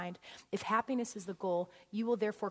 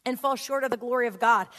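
scripture proclamation; 2 semitones higher, 9 dB louder than usual. Acted. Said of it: "says this".

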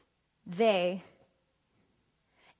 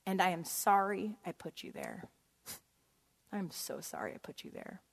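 soft, fading sorry; tender apology; 3 semitones lower, 7 dB quieter than usual. Retold or imitated. Wife apologizing tenderly to husband, fading soft. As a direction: apologize gently to him. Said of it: "I'm like".

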